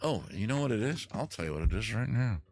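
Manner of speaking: normal talking voice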